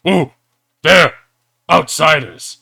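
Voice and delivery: loud booming ape yell; giant ape shouting. Acted. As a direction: bellow it like a giant ape spotting intruders. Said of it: "and says".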